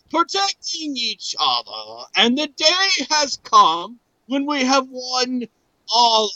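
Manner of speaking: high, theatrical speech to warriors; grand oration. Acted. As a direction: declaim like a heroic leader, in swells. Reads translated letter as grand oration.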